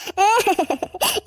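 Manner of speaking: low, stuttering sob; wordless tearful sobs; mother's sobbing. Acted. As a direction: break into acted weeping. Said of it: "அம்மா, அழாதீங்கம்மா".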